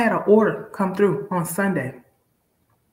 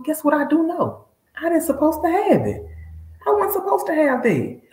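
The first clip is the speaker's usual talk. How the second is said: much higher, lively exclaiming; excited; 13 semitones higher than usual. Real, not acted. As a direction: let it spill out, thrilled. Excited realisation about God.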